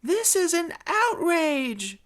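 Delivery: high, drawn-out indignant cry; outraged shout. Acted. Zeb protesting from Group D cage, shouting indignantly. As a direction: shout it slowly with mock-grand indignation. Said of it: "shouted Zeb from the Group D cage".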